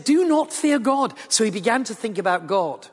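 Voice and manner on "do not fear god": mock shout, pitched well up; retold shout. Criminal calls across Jesus to his friend, mild retold shout. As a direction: call out across, only half shouting. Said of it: "shouts across Jesus to his friend".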